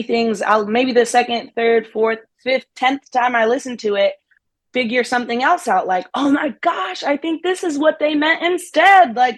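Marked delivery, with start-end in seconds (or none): 6.1-9.2 higher-pitched excited mock epiphany; retold eureka moment, pitched up; excited realization. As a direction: act out suddenly figuring it out. Acts out a sudden realization.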